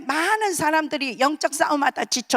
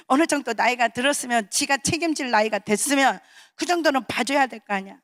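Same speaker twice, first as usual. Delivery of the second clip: ordinary talk, nothing marked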